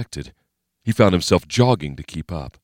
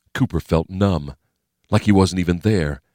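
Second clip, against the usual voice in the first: plain reading voice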